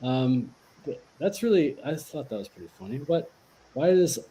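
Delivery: ordinary talk, nothing marked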